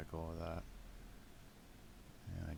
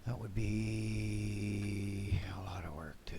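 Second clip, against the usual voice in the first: slow whispered aside; whispering; 3 semitones higher, much slower than usual. Real, not acted. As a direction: breathe it out slowly. Aside while building.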